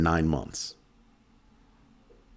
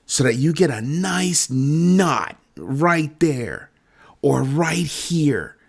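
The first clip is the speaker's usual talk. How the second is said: slow savoring; 7 semitones higher, slower than usual. Real, not acted. Savoring the imagined punch.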